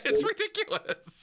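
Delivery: high, breaking into real laughter; laughing. Real, not acted. Interviewer cracking up at guest's story, laughing.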